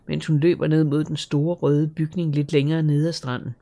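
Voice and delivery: plain reading voice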